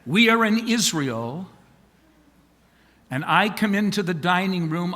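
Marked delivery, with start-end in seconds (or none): none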